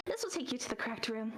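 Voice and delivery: brisk clerk. Acted. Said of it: "and she goes".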